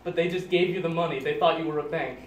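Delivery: usual speaking voice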